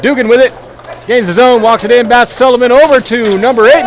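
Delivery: lively hockey commentary; play-by-play. Acted. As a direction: call the game as it happens.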